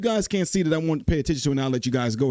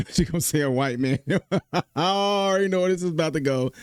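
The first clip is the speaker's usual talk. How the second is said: laughing; 3 semitones higher than usual. Real, not acted. Host laughing at the clip.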